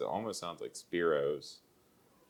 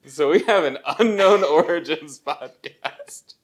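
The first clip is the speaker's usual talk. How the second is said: laughing; 9 semitones higher, 11 dB louder than usual. Real, not acted. Laughs at own mistake.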